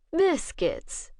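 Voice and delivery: amused agreement. A narrator's reading. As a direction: indulge her with a quirk of a smile. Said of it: "Bishop agreed with a quirk of a smile".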